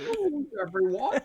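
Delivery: put-on high, wobbly old professor voice; Futurama professor impression. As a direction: crow it like a cartoon scientist. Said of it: "trying to do the Futurama".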